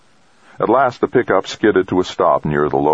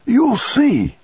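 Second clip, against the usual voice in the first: excited urging. A narrator's reading. Grandfather urging his grandson out the door, excited high cry. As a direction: urge him on with a sudden high cry.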